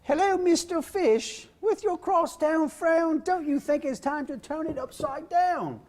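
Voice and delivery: very high, slow falsetto British lady; British female voice. Acted. Dad reading Pout Pout Fish to kids on his knees, British female voice. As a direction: read it in a drawn-out, high-pitched British woman's voice. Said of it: "my British female voice".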